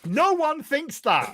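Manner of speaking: high, drawn-out indignant objection; indignant disbelief. Real, not acted. Player objecting to a friend's view of breakfast, indignant. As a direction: object slowly, pitch swooping right up. Disputing the best breakfast food.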